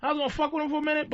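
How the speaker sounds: excited outburst. Real, not acted. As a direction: blurt it out fast. Sudden excited realization.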